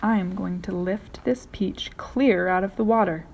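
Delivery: usual speaking voice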